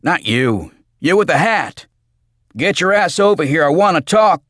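loud, high, commanding shout; bellowed order. A narrator's reading. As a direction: holler at someone to come over. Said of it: "he bellowed".

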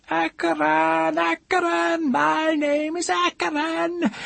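very high, drawn-out scream; screaming aloud. A narrator's reading. Woman screaming her secret name aloud, shrieking. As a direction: scream the name out, slow and loud. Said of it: "Then she screamed aloud".

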